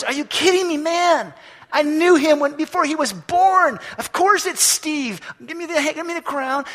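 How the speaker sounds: high, loud, delighted disbelief; excited Jesus. Acted. Jesus laughing off the angel's prompt, high and loud with joy. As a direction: burst out like of course he knows his friend. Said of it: "Jesus looks at the angel and goes".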